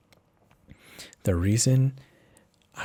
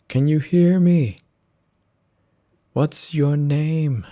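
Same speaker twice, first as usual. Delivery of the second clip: loud, higher, slow call to a waking patient; doctor calling out; 8 semitones higher, 8 dB louder, slower than usual. Acted. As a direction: raise the voice to reach someone coming round. Doctor rousing patient after accident.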